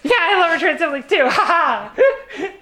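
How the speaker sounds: high, loud, laughing through it. Real, not acted. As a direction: giggle the words out. Laughing joint sign-off.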